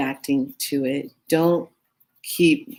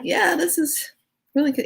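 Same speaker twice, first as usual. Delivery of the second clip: much higher, tearful insistence; squeaky strained praise; 12 semitones higher than usual. Retold or imitated. Sweating through the sauce, insisting to her friend, much higher squeak. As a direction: insist it's good through the burn. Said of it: "I'm like".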